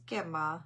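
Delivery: Grandma said as "Gemma", dropping the r; toddler pronunciation. Shown as a demonstration. Grandmother showing how her grandson says it, toddler pronunciation. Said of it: "It's the way he says it".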